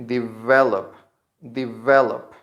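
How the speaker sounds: "develop" stressed on VE with a clear v. Shown as a demonstration. Teacher tells learners how to say it, stressed VE. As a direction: hit VE in "develop", di-VEL-up. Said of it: "the stress is in ve".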